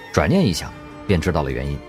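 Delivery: normal talking voice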